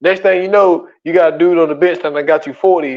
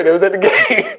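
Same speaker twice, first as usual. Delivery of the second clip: raised voice dissolving into laughter; laughing; faster than usual. Real, not acted. Dad admitting the kids were at the game, laughing. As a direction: laugh helplessly through the last words. Laughing at having nobody to scold.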